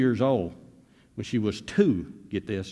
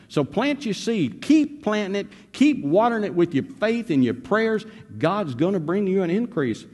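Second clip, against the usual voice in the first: full-force preaching; 8 semitones higher than usual. Acted. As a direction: drive it home to the congregation. Preaching exhortation.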